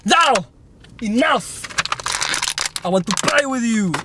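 high, punchy outburst; hammed-up whiny demand; mock tantrum. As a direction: throw a childish fit.

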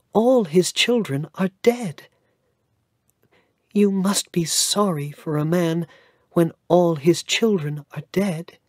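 put-on high, earnest child's voice; boy telling the lawyer about his grandfather; little boy Cedric. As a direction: speak as an innocent young boy.

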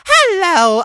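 very high, loud blurted hello; over-eager greeting. A narrator's reading. Voicemail caller overshooting his greeting, loud and very high. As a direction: squeal it out far too eagerly.

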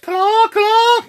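very high, loud "caw" honks; loud goose imitation. Shown as a demonstration. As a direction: cry out like a goose taking off. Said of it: "my best rendition of a live goose".

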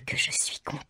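breathy whispered joy; mother sighs out her happiness in a whisper; whispered delight. A narrator's reading. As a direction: breathe it out in rapture. Joyful aside.

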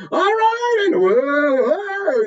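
squealing way up high, stretched slowly; overdone drama voice. Shown as a demonstration. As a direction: ham it up like bad theatre. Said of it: "it's not a drama".

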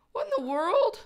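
high amazed squeak. Real, not acted. To her dog, a high amazed squeak. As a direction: squeak it out in disbelief.

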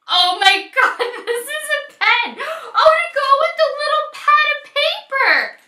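high, loud, delighted squeals over the gift; excited squeal. Real, not acted. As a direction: squeal with real joy at the surprise. Unboxing a surprise pen gift.

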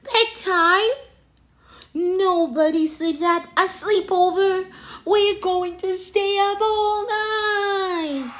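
high, loud, incredulous outburst; overjoyed storybook chimp, almost singing at the end; excited protest. A narrator's reading. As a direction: exclaim it, stretching the final words. Jim scoffs at bedtime, excited.

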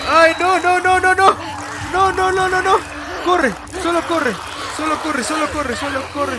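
panicked high pleading shouts; desperate high screams; being chased. Real, not acted. Fleeing enemies in game.

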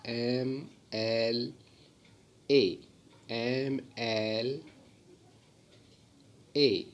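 एम एल ए said letter by letter, very slowly, opening on the vowel "em"; एम एल ए drawn out letter by letter as "em el ay"; slow spelled out letters. Shown as a demonstration. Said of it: "इसका pronunciation है वो ऐसे निकल रहा है".

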